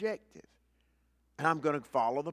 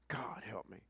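breathless whispered plea; whispered prayer; 7 semitones lower, 15 dB quieter than usual. Real, not acted. Preacher murmuring to God mid-sermon, whispered. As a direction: breathe it as a quick private prayer.

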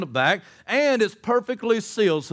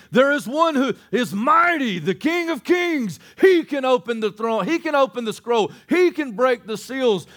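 triumphant proclamation; 4 semitones higher, 3 dB louder than usual. Real, not acted. Preacher proclaiming the one who can open the scroll, triumphant. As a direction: ring it out, hammering each declaration.

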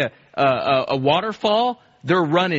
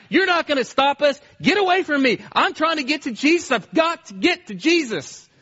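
desperate cry; 9 semitones higher than usual. Retold or imitated. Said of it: "They kept crying out".

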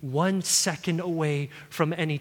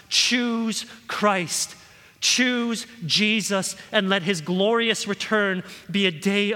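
higher, louder pleading altar call; impassioned preaching; 6 semitones higher, 3 dB louder than usual. Real, not acted. Preacher begging listeners to choose Jesus, impassioned preaching. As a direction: urge them to choose Christ now.

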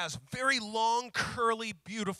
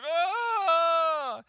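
put-on high, loud child's crying wail; a six-year-old wailing in tears; imitated wail; 10 semitones higher, 9 dB louder than usual. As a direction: wail like a little girl bursting into tears. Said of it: "Bursts into tears".

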